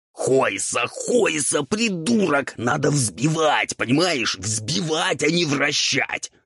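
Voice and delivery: high raspy ghoul tirade; exasperated scolding. A narrator's reading. Ghoul corrects devil whirling the eggs, exasperated. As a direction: drive each instruction home, furious and raspy.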